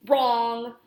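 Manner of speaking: loud, firm single-word retort; wolf firmly correcting the reader; emphatic retort. Acted. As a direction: shut the doubt down hard.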